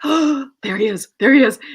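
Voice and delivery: high, gasping thrill; starstruck excitement. Retold or imitated. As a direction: blurt it out, giddy at spotting an idol. Acts spotting her idol.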